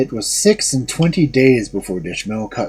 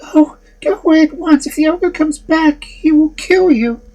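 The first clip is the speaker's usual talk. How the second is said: put-on high young woman pleading, urgent; high, frightened warning; high maiden voice, urgent; 5 dB louder than usual. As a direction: urge him away in a high maiden voice. Maiden warns him urgently.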